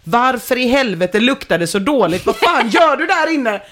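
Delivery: indignant mock yelling, pitch climbing high; acted outrage. Girlfriend outside reacting to the stench, acted outrage.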